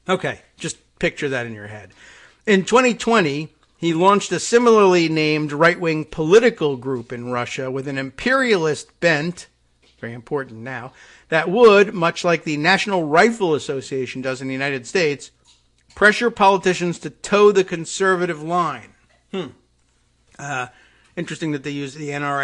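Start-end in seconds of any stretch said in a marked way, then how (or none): 2.5-9.5 louder, emphatic reading voice; article read-aloud. Real, not acted. Host reading a report to listeners, emphatic read-aloud. 11.3-18.9 louder, emphatic reading voice; article read-aloud. Real, not acted. Host reading a report to listeners, emphatic read-aloud.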